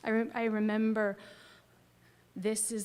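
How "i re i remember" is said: normal talking voice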